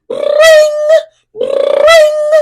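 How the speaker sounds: high loud ringing bell call; imitated alarm bell. A narrator's reading. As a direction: ring out like a bell. Fire station alarm bell.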